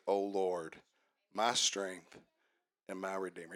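scripture reading. Acted. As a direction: read the verses quietly, like a prayer. Reads psalm before the sermon.

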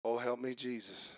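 low, very soft aside; exasperated little prayer, acted out quietly; muttered plea. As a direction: mutter it under your breath. Acts spouse's aside during a debate.

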